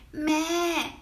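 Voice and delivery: a short bleating meh; sheep bleat. Shown as a demonstration. Teaching viewers the Chinese sheep sound, bleating. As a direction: bleat it like a lamb. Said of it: "we always say".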